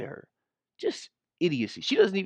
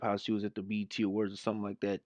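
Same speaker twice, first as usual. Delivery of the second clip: ordinary talk, nothing marked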